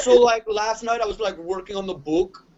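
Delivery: exaggerated Minnesota accent impression of a friend; Jesse Cannon Minnesota accent. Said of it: "a Minnesota accent".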